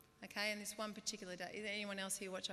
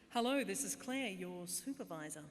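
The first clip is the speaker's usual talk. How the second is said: put-on phone voice, loud hello then slowing; pretend supervisor; 6 dB louder than usual. Call-centre worker faking her own supervisor, put-on official voice. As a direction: pose as your own boss on the line. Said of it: "the supervisor was always called Claire".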